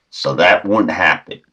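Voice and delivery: ordinary talk, nothing marked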